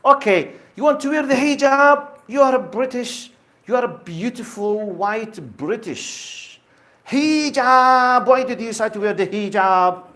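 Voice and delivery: imitating the presenter's scornful "hijab"; mocking presenter. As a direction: make fun of her choice, pitch up. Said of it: "as if he was making fun of her".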